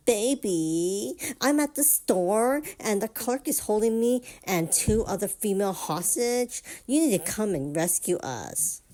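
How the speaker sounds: high, whiny put-on baby voice; pitiful whiny voice. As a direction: whine it pitifully to her husband. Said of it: "puts on this really pitiful whiny voice".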